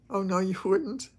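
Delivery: ordinary talk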